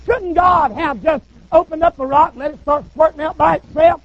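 ordinary talk, nothing marked

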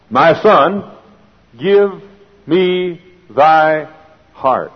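slow verse, long pauses between words; weighty, word-by-word recitation; solemn scripture quote. Acted. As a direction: set each word of the verse apart.

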